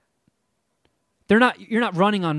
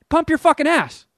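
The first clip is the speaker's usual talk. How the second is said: high, sharp comeback; angry retort; 11 semitones higher, 4 dB louder than usual. Retold or imitated. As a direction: fire it right back at him. Snapping back at the yelling guy.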